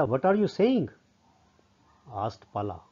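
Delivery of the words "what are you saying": higher-pitched acted query; puzzled question. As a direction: ask as if taken aback. Acting Pala's puzzled question.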